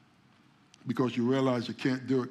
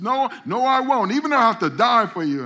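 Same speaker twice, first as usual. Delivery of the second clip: higher, louder eager insistence; Peter's protest; 10 semitones higher, 11 dB louder than usual. Retold or imitated. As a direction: insist hotly you'll never fall. Peter protests his loyalty.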